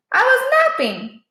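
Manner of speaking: high, casual dog voice; Boss voice. A narrator's reading. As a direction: toss it off as a scrappy dog.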